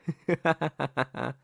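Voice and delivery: rippling laugh, trailing down; amused laugh. Acted. Correcting "you" to "us" for the partner, amused laugh. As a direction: laugh lightly at your own correction.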